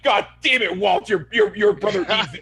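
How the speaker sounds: exasperated mock yelling; angry outburst. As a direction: imitate a group fed up with Walt. Everybody retold snapping at Walt.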